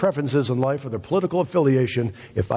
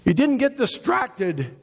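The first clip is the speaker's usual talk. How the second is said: full-force preaching. Real, not acted. Preacher praising the blind man's focus, fervent proclamation. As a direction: preach it at a high, sustained pitch.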